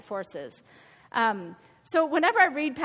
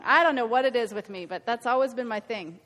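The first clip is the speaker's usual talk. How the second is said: usual speaking voice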